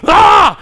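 sudden loud high exasperated shout; frustrated yell. Retold or imitated. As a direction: cry out like stuck at the crossing. Frustrated cry at the train.

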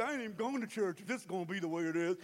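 low, fast, soft mock grumbling; babyish fit. Churchgoer pitching a fit, fast low sulky grumble. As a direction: rattle off a petulant complaint. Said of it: "pitch a fit like a baby".

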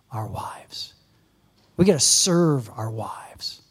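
hushed, whispered plea; earnest whispered urging. Real, not acted. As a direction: drop to a whisper for weight. Preacher drops to whisper.

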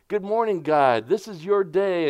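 high, bright good morning to God; cheerful morning greeting. Shown as a demonstration. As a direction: greet God like the day just began. Acting out a morning prayer.

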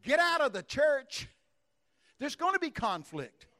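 loud, high and slow; slow shout. Acted. Preacher telling the timid to leave, slow loud shout. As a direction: drive each word out like a command. Preacher mocking conflict-shy Christians.

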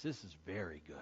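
whispered awe. Acted. God admiring humanity, in a hushed whisper. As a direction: breathe it like a secret marvel.

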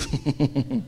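quiet low chuckle; a brief breathy laugh; soft laugh. Real, not acted. Pastor chuckling at his own [name] joke, soft laugh.

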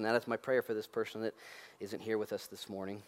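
ordinary delivery

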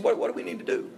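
high, breathy whiny plea; whiny whisper. Retold or imitated. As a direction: whine it like a needy kid. Crowd whining to Jesus.

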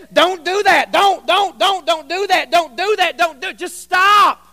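a preacher yelling prohibitions, parodied; mock preacher shouting. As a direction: shout don't at the congregation in mock fury. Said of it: "he said".

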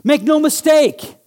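high, loud fervent sermon voice; preaching at full force. Real, not acted. Pastor preaching Jesus' words to the congregation in full fervour. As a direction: preach it with passion.

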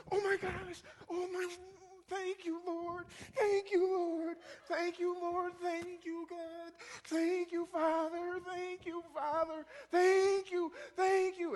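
high-pitched sobbing thanks; tearful gratitude. Retold or imitated. Keisha turns around and thanks the Lord, acted tearful relief.

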